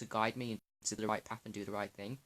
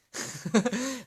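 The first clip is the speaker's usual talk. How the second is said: short, high, loud laugh; burst of laughter; 13 semitones higher, 7 dB louder than usual. Real, not acted. Vlogger laughing at what fasting will do to him, laughing. Laughs about expecting raw feelings.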